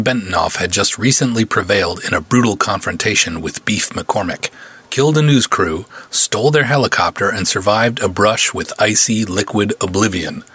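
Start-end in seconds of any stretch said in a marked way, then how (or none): none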